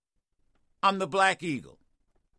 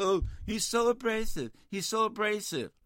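higher mock critic voice; lightly pitched-up sneer at critics; 4 semitones higher than usual. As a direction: whine it to ridicule critics. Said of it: "I hear people".

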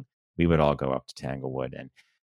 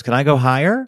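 put-on high little-girl voice, louder; eager kid's question, pitched up; Madeline; 9 semitones higher, 6 dB louder than usual. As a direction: imitate a child calling down from a tree. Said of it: "and she goes".

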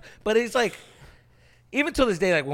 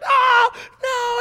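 high, loud panicked yell; 10 dB louder than usual. Retold or imitated. Kid yelling as cousin blocks his screen, loud and high. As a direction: cry out as your face gets covered.

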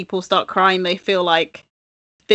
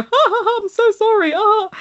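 high, fake-laughing apology; squealed pretend laughter while saying sorry; mock laughing fit; 11 semitones higher than usual. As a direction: apologise through put-on hysterical laughter. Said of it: "you're laughing your head off".

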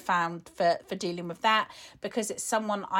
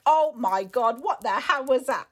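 louder, half-laughing disbelief; mock outraged what-the-hell; shocked outburst; 3 semitones higher, 5 dB louder than usual. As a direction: blurt it out like a stunned rant. Acting out her own rant.

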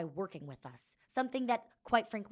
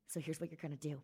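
normal talking voice

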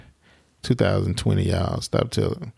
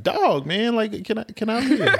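laughing; 12 semitones higher, slower than usual. Real, not acted. Laughing plea for privacy.

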